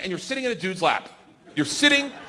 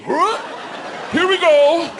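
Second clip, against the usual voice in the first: high, loud launch call; imitated instructor shout; 9 semitones higher, 4 dB louder, slower than usual. Instructor hauls comic to the door, high loud holler. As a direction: holler it while hauling someone.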